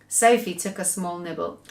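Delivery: ordinary delivery, nothing marked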